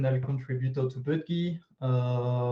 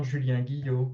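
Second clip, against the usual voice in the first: name said with French accent; 3 dB louder than usual. Shown as a demonstration. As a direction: Julien Guillot in native French accent. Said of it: "pronounced with my magnificent French accent".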